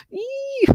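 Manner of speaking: high, soft squeal; a tiny high squeal of joy; happy squeal. Real, not acted. Streamer squeals happily for her friends.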